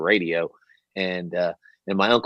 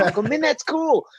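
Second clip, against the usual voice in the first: put-on impressed kids. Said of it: "And they were".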